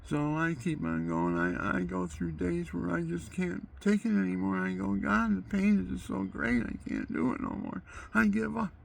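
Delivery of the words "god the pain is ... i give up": despairing cry to God. Retold or imitated. Retells crying out to God.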